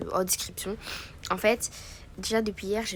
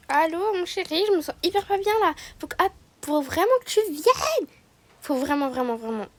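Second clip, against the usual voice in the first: panicked phone call; 7 semitones higher, 5 dB louder than usual. Pretend. Sick wife phones husband urgently.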